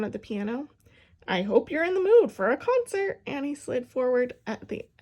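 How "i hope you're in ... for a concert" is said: high, loud, eager announcement; excited. A narrator's reading. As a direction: bubble with excitement. Excited girl announces concert.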